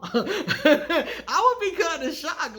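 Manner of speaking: high, loud, slow laugh running through the words; real amused astonishment; laughing shock. Real, not acted. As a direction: laugh in disbelief as you say it. Imagining being asked kindly.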